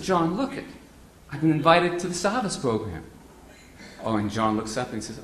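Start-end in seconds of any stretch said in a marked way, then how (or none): 0.0-3.0 high, eager, bursting with news; can't wait to tell him; excited announcement. Retold or imitated. Telling John about the invitation, high and excited.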